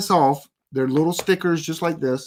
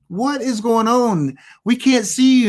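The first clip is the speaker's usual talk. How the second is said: higher, slowed, puzzled colleague asking; confused coworker on Zoom; 7 semitones higher than usual. Retold or imitated. Coworker on a Zoom call, puzzled by the blank camera. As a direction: play a baffled coworker who can't see you. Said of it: "they go".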